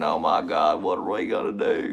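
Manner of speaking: low Southern politician impression; Bill Clinton. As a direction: drop it deep and draw out the words. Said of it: "You gotta have Bill Clinton".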